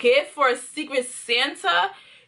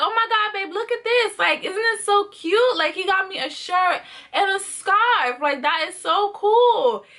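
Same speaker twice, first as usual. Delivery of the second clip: higher, laughing, gushing to her man; gushing excitement; 6 semitones higher than usual. Retold or imitated. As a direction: show off the gift all excited. Showing her boyfriend the gift.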